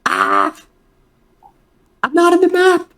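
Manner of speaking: high startled yelp; shocked, squeaky, up high; panicked surprise. Real, not acted. Player panics, token missing.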